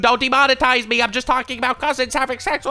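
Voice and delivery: loud, high pleading disclaimer; mock panic. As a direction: plead frantically, voice pushed up. Panicked disclaimer to avoid demonetization.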